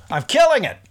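high sarcastic self-praise; ironic boast. Real, not acted. Host mocking his own stumbling, sarcastic high. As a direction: brag sarcastically after failing.

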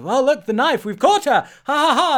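high, loud triumphant crowing; mock gloating king. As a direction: gloat like a pantomime villain, fake laugh. Acted king gloating over capture.

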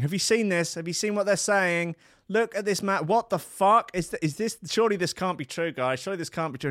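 high, alarmed chorus voice; mock fretting family; panicked relatives. Family and friends texting, high and alarmed. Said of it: "family and friends going".

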